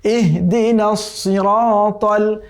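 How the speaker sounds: slow, melodic tajweed chant drawn out at the end; chanted Quranic recitation. Shown as a demonstration. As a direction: recite the verse once, slowly, with full tajweed. Recites the verse.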